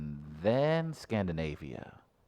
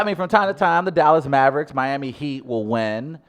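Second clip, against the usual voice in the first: sports hype; 8 semitones higher, 9 dB louder than usual. Acted. Host hyping his racism rankings, booming and high. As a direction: preach it like a hot-take host.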